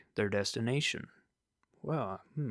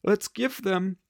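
much higher, louder sing-song villain voice; mock cheerful villain; 11 semitones higher, 5 dB louder than usual. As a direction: play a gleeful cartoon villain. Voicing Nazi doctors cheerfully.

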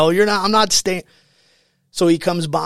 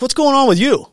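drill instructor demand; 9 semitones higher, 6 dB louder than usual. Retold or imitated. Senior drill instructor questions recruit.

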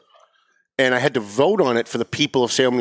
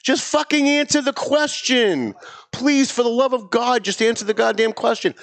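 exasperated plea; 8 semitones higher than usual. Real, not acted.

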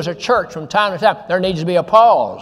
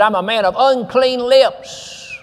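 slow, higher, humbled voice of Isaiah; humble confession; 5 semitones higher, slower than usual. Retold or imitated. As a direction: confess it slowly, pitched up and broken-down.

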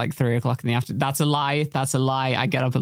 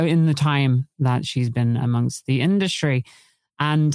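normal talking voice

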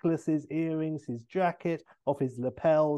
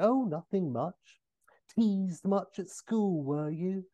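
higher, slow mock-innocent needling; Austin Powers; 6 semitones higher, slower than usual. Austin needling O'Brien about his name, mock-innocent. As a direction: tease with feigned innocence. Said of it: "And Austin".

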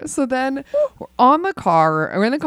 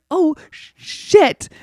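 panicked curse; 7 semitones higher, 4 dB louder, much slower than usual. Retold or imitated. Panicking while driving.

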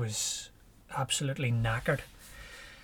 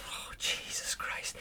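breathless whisper, slow; whispered oath; 11 dB quieter, slower than usual. Retold or imitated. Runner whispers to himself after the dog runs off, slowly. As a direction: hush it out, shaken and slowed.

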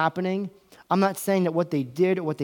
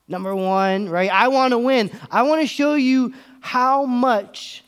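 mock self-centred arguer; 5 semitones higher, 5 dB louder than usual. Voicing selfish arguer.

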